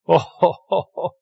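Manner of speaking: normal talking voice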